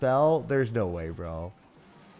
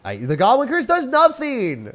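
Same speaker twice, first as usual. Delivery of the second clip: higher, louder exasperation; exasperated shout; 13 semitones higher, 8 dB louder than usual. Real, not acted. Streamer yells at his useless spell. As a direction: yell it in disbelief.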